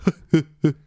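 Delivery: loud, huffed staccato impression laugh; mimicked comedian's signature laugh; Eddie Murphy. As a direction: cap the bit with throaty huffs. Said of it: "That's my Eddie Murphy laugh".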